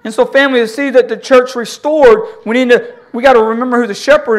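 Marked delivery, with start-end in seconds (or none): none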